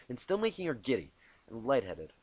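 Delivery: plain reading voice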